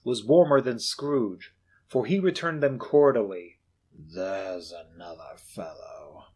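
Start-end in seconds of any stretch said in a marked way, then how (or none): 3.9-6.3 low, slow, hushed grumble; muttered. Acted. Scrooge grumbling about his clerk, muttered. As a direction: grumble under your breath. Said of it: "muttered Scrooge".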